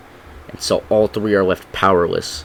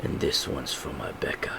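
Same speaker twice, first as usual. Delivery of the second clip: low whispered vow; very soft, low and grave; Butcher; 6 semitones lower, 14 dB quieter than usual. Acted. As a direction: whisper it as a final payback. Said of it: "Butcher says".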